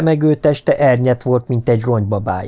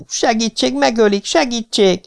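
urgent high-pitched plea, held-back volume; pleading cry, pitched way up; crying for help; 11 semitones higher than usual. A narrator's reading. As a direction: call desperately for someone to come. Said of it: "ordította Hegarty".

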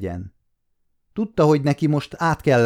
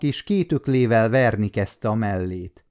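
plain reading voice

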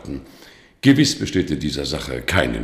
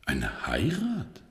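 surprised; 8 semitones higher, 5 dB quieter, much slower than usual. Acted. Bischof überrascht von Heiratsplan.

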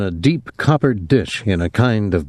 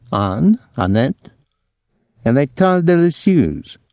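bad French accent; 6 semitones higher than usual. A narrator's reading. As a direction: savour the French in a poor accent. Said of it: "didn't work on his accent".

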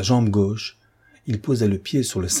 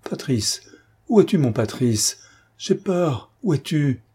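higher, frightened pleas; panicking in the dark, voice rising higher; panicked; 5 semitones higher than usual. A narrator's reading. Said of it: "que les ténèbres affolaient". (panique, voix plus aiguë)

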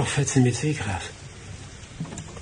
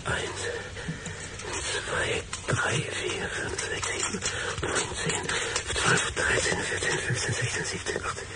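quiet unvoiced counting; whispered counting; 7 dB quieter than usual. Man counting the objects in the box, whispering. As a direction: tick them off under your breath. Counting items under his breath.

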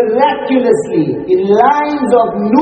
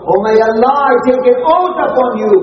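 higher, urgent appeal to God; fervent oath; 5 semitones higher, faster than usual. Acted. Kifl speaking to Allah in repentance, fervently swearing. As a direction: call the vow upward with conviction.

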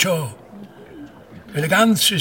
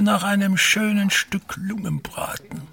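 hushed craving; 6 semitones higher than usual. Acted. Unvoiced whisper detected.

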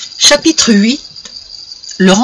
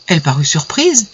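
usual speaking voice

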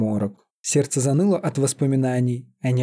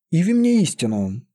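higher-pitched, firm order; commanding; 5 semitones higher than usual. A narrator's reading. Frowning witch steps back and orders the mantle, commanding. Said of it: "отступила и приказала".

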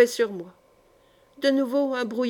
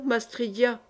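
usual speaking voice

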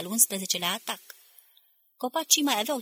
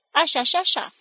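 loud, higher called-back reply; answering call; 7 semitones higher, 8 dB louder than usual. A narrator's reading. Other voices answering across the battlefield, calling back. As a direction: call back with a raised, lifted voice. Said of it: "La care altele răspundeau".

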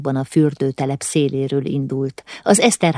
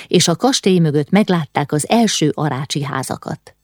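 ordinary delivery, nothing marked

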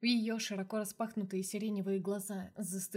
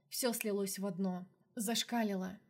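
ordinary delivery, nothing marked

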